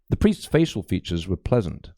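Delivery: usual speaking voice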